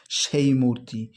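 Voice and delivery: usual speaking voice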